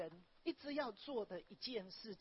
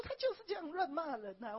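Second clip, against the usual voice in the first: indignant; 7 semitones higher, 5 dB louder than usual. Retold or imitated. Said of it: "他说".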